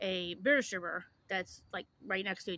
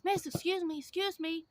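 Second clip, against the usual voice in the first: pleading beggar voice; 9 semitones higher than usual. Retold or imitated. Imitating a woman begging at the gas station, high pleading call. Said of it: "she's like".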